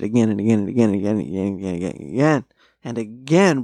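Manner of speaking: fast, run-together string of repeats; rapid-fire repetition. Retold or imitated. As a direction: rattle it off like an endless loop. Acts out eager rewatching.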